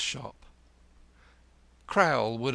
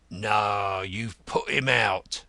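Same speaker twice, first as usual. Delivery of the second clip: growled; 5 dB louder, slower than usual. Acted. Gentleman answering the caller at his own door, growling. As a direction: growl it out slowly. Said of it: "growled the gentleman himself".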